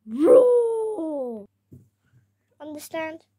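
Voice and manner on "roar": mock roar. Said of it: "Ooh, scary".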